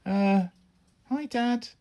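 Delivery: put-on high, hesitant child's greeting; a boy caught stowing away greets his dad; timid. A narrator's reading. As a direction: caught out, small and nervous. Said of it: "I said timidly".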